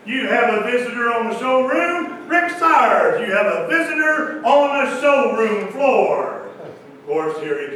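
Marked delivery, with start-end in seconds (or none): 0.0-6.4 loud, high call over the intercom; intercom page. Retold or imitated. Salesman paging Rick over the intercom, loud triumphant call. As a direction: page him like a PA announcer. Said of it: "I go to the intercom and I said".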